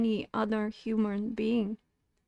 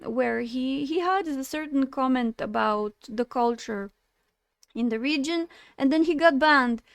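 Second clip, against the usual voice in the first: usual speaking voice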